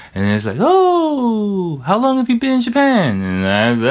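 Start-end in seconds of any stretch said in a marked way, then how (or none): 0.6-3.2 much higher mock-surprised falsetto; surprised Japanese staff. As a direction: imitate an amazed Japanese waiter, way up high. Imitating Japanese staff questions.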